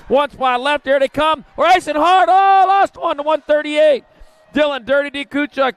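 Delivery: announcer going up as the last transfer spot is decided; excited call. Real, not acted. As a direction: build to the finish line. Close finish for last spot.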